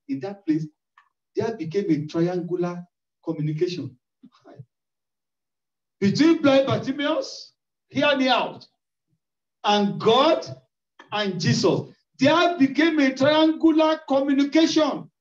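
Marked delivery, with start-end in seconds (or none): 6.0-15.1 louder, emphatic sermon climax; loud preaching. Real, not acted. Preacher exhorting the congregation, loud and forceful. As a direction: drive each phrase home, loud.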